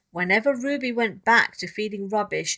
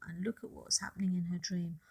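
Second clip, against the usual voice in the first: whisper; 3 semitones lower, 12 dB quieter, faster than usual. Real, not acted. Reader whispers to children.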